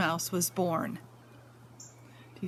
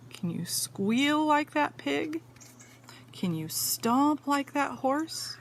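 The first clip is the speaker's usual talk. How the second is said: plain reading voice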